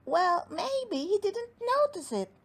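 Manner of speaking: high gnome voice, reasoning; Cowslip reasoning. Acted. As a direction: talk the crowd round. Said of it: "replied Cowslip".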